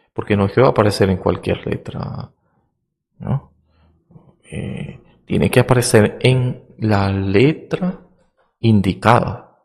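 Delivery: ordinary talk, nothing marked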